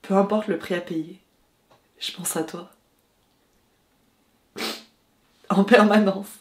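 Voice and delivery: hesitant, intimate love confession; film monologue. Acted. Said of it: "voyons si j'arrive à recréer cette sensation".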